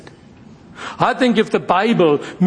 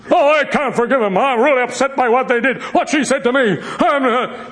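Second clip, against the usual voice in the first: higher, whiny mocking voice with a laugh; mock whiny grudge-holder; 5 semitones higher than usual. Pastor mocking a grudge-holder to the church, whiny. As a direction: parody someone who won't forgive.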